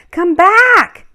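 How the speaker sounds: high, loud call after a runaway. Acted. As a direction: shout after the lamb as it bolts. Boy calls after running lamb.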